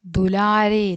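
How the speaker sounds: syllable by syllable, drawn out; slow model reading. Shown as a demonstration. Teacher sounding out the Hindi words slowly. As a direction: read it slowly for the pupils to copy. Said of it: "teacher reads the Hindi words slowly".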